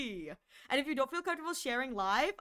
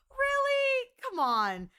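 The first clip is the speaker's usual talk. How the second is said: much higher, louder disbelieving really; mock outrage at bad writing; incredulous; 12 semitones higher, 4 dB louder, much slower than usual. Real, not acted. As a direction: squeak in disbelief. Incredulous at fanfic.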